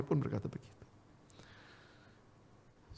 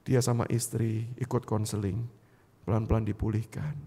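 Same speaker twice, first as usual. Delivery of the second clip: ordinary talk, nothing marked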